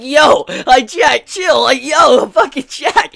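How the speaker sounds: loud, high, laughing disbelief; hyped-up amazed reaction; amused outcry. Retold or imitated. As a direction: exclaim like a fan reacting to a savage burn. Exclaiming at Jack's bluntness, laughing.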